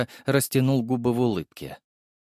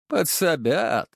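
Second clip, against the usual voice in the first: sly villain; 6 semitones higher, much slower than usual. A narrator's reading. Villain voice, slow.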